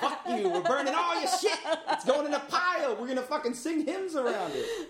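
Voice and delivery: high, loud laughing rant; hammed-up zealot yelling through laughs; mock rant.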